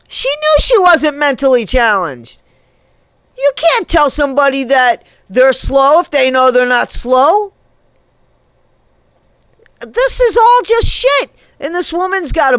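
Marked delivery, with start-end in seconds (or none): 0.1-7.5 indignant raised voice. Real, not acted. 9.8-11.3 high, slow, loud and fed up; disgusted outburst. Real, not acted. Venting disgust at the story, slow loud exasperated outburst.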